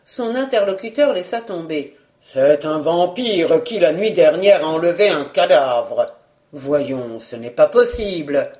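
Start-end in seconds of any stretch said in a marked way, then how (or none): 2.2-6.1 lightly voiced cavernous bystander. A narrator's reading. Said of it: "D'une voix caverneuse".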